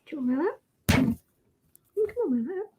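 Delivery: sweet high sing-song pet voice; high cooing. Real, not acted. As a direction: coo up high to coax the cat. Cooing to the cat.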